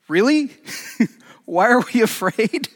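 high, loud disbelief with a laugh; the disciples balk, half laughing; incredulous disciples. Retold or imitated. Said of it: "probably they're saying".

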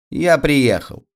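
put-on high boyish call; mock announcing shout. A narrator's reading. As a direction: announce it in a high, lightly acted cry. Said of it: "крикнул Петька".

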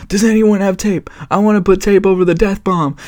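high-pitched impression of a movie raccoon; Rocket Raccoon. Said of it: "Rocket Raccoon is like".

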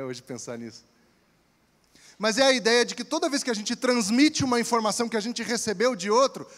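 usual speaking voice

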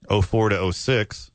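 normal talking voice

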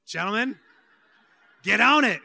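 half-laughing call to the men, rising to a loud push; laugh, then call out get on it; playful loud nudge to the gentlemen. Real, not acted. Calling out to the men.

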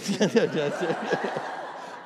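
breathy trailing laugh; real laughter. Real, not acted. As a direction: laugh it off after the stunt. Laughs after the demonstration.

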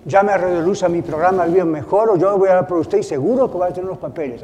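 loud, fast hammed-up radio pitch; a pastor mocking a radio preacher with a loud, fast sales pitch. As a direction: sell it like a pushy radio evangelist.

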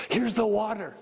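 acted out-of-breath delivery after a big gasp; gasp, then push the words out; mock winded messenger; panting. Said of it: "You can hear him panting".

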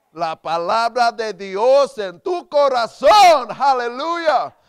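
loud, high, drawn-out Spanish proclamation; fervent preaching shout. Real, not acted. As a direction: belt it out slowly to the congregation. Preacher repeats line in rising fervour.

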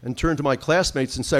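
usual speaking voice

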